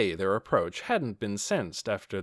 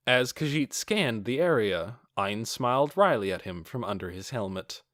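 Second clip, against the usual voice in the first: plain reading voice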